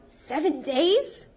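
high, loud and slow disbelief; the Basha aghast at leaving home a week; shocked. Acted. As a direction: stretch it out, appalled.

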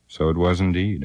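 ordinary delivery

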